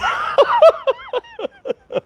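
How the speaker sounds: high-pitched peal of laughter; long helpless laugh; real laughing fit. Real, not acted. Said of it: "I'm laughing".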